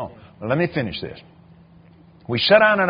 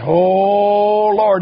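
amazed oh Lord; 6 semitones higher, 7 dB louder than usual. Retold or imitated. Preacher's amazed reaction.

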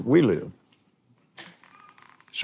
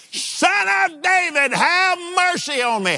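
shouting louder. Retold or imitated. Blind beggar shouting louder over the crowd to Jesus. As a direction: throw it over the crowd, even bigger. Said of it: "He just shouted the louder".

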